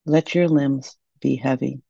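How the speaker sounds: ordinary delivery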